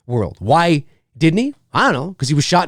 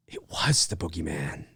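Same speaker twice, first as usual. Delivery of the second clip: spooky hush; 10 semitones lower, 13 dB quieter than usual. Acted. Spooky hushed punchline.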